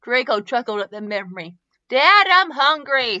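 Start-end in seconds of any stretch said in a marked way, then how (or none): 1.9-3.2 put-on slow, loud, whiny children; whining kids. A narrator's reading. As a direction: drag out the complaint like hungry kids. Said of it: "Scorpius and Leo whined".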